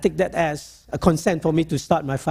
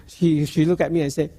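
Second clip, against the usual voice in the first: normal talking voice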